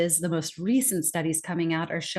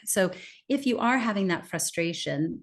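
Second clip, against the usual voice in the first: normal talking voice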